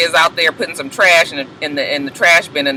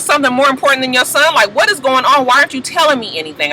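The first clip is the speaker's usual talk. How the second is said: high, fast exasperated demands; indignant wife; 9 semitones higher, faster than usual. Retold or imitated. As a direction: fire off the questions high and fast, fed up. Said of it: "And she was like".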